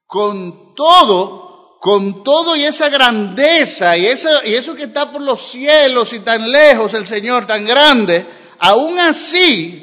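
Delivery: loud, drawn-out emphatic reading of scripture; preacher proclaiming the verse to the congregation; fervent preaching. Acted. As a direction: lean hard into every word of the verse.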